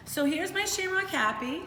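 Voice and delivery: high and bright, showing off a happy face; excited. Shown as a demonstration. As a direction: cheer it out for the class. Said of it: "shows her happy face".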